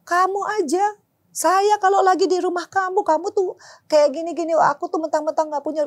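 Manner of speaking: mocking offended husband. Wife imitating husband offended over closed door, mock whine. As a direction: mimic his touchy complaint.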